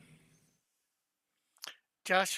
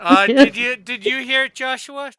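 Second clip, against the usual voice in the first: loud laughing; 6 semitones higher, 19 dB louder than usual. Real, not acted. Checking whether anyone heard it.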